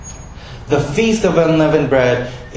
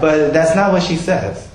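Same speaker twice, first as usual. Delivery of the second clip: normal talking voice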